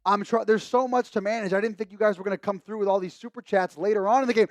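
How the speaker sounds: ordinary delivery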